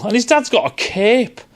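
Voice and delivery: usual speaking voice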